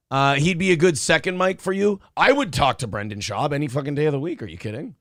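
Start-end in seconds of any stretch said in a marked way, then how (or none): none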